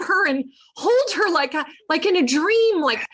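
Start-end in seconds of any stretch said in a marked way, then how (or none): none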